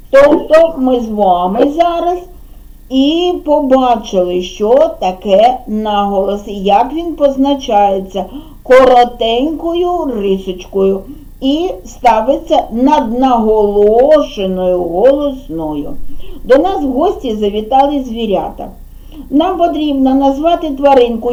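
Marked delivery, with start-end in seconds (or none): none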